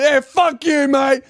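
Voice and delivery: high-pitched, indignant shout; retold angry yell. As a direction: act out shouting down a harasser. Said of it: "as soon as I yelled at him".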